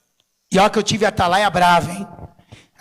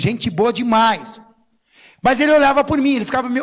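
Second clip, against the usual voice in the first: ordinary talk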